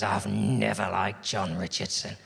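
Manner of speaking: low, slow, weighty read-out; deliberate disdain. Acted. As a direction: dwell on each word, low and disdainful. Hostile tweet read out slowly.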